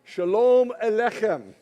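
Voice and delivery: Shalom Aleichem slow and loud with a throaty ch; Hebrew diction. Shown as a demonstration. Preacher leading the church in the greeting, slow and loud. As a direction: model Shalom Aleichem for repeating. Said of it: "Very, very good diction".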